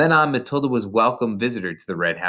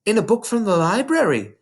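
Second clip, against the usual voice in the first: put-on lightly raised little girl voice; Matilda's voice; 10 semitones higher than usual. A narrator's reading. Narrator voicing young Matilda to her teacher, small girl voice. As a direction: voice a bright, earnest young girl.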